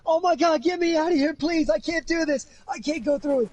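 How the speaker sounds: very high, frantic female impression; panicked runaway bride. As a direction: imitate a bride desperately pleading to escape. Runaway bride begging for help.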